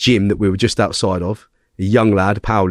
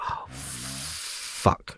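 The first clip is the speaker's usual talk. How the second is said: dismayed mutter; 11 semitones lower, 15 dB quieter than usual. Retold or imitated. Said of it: "And I'm like".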